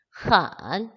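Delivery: 흔 drawn out with a dipping third tone. Shown as a demonstration. Teacher showing learners how to pronounce it, 흔 in third tone. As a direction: say 흔 loudly, letting the pitch fall low then rise. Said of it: "삼성이에요".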